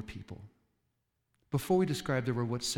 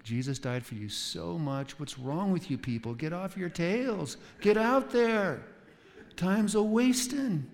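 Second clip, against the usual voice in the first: mock hellfire preacher, building to a slow loud push; hammed-up scolding, slowing and rising to the end; browbeating preacher; 5 semitones higher, 3 dB louder, slower than usual. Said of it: "It's called browbeating".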